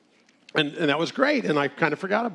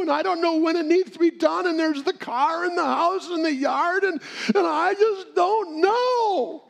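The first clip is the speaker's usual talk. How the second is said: much higher, near-shouting, rising panic; frantic mock widow; 12 semitones higher than usual. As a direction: play her overwhelmed and desperate, climbing higher. Widow overwhelmed by household problems.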